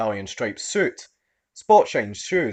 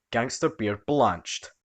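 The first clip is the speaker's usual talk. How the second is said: plain reading voice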